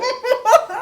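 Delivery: high burst of laughter at a friend; mocking laugh. Real, not acted. Mocks friend's Bellingham pick.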